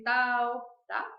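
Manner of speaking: ordinary talk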